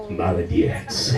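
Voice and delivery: put-on low, slow voice of a smoking toddler; gruff baby voice. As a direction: drop way down for a jaded baby.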